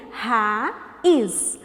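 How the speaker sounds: RAIZ broken into two syllables, "ra... iz". Shown as a demonstration. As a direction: stretch each syllable of Raiz. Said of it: "vamos separar as sílabas". (Raiz separado em sílabas)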